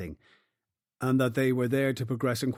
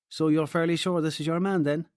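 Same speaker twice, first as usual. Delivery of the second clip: higher, questioning Cork lilt; Cork accent; 5 semitones higher, 3 dB louder than usual. Acted. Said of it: "in a strong Cork accent".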